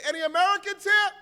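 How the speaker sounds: high, loud call across the airport hall. Retold or imitated. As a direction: shout for the Americans in line. Airport official calling out passengers.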